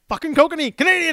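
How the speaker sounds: high and loud, pointing and calling out "Canadian!"; excited yell. Retold or imitated. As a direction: shout it across the bus stop in delight. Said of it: "I yelled".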